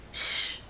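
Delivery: soft, voiceless shush. Acted. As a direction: hush someone in hiding. Hidden girl hushes Eric.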